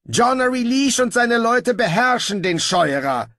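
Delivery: high, loud ruler's voice; pompous ruler. Acted. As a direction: proclaim it high and loud, sure of your power. Pompous ruler insists he is safe. (herrisch und selbstgefällig)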